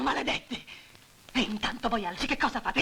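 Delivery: usual speaking voice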